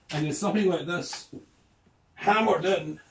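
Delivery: normal talking voice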